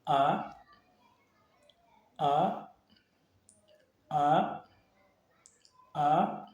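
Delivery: "A" rising at the end; short sweep upward on each "A"; second tone. Shown as a demonstration. Teacher drills the second tone for learners, "A" rising. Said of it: "Le deuxième".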